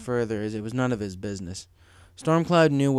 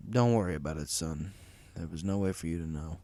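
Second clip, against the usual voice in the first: lower, softer reassurance; reassuring; 6 semitones lower, 6 dB quieter than usual. Acted. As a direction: boss gently comforts a worried employee. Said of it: "Stormcloud reassured his new employee".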